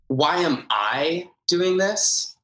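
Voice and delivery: "I" stressed and drawn out in a slow rising question; slow, pointed "I" in the middle. Shown as a demonstration. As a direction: lean on "I". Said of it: "emphasis on different words".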